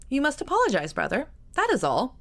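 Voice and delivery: put-on high, firm young sister pleading; urging sister. Georgiana urging her brother.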